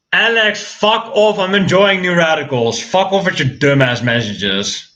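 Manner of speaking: high-pitched irritated telling-off; annoyed outburst. Real, not acted. Streamer telling [name] off for interrupting New Radicals, annoyed. Snapping at [name] over messages.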